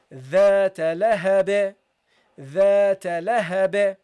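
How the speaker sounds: slow, loud recitation of "ذات لهب" with a bouncing final b; bounced final ba. Shown as a demonstration. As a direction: recite "ذات لهب", releasing the last b with a short echo. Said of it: "you have to give it a bounce".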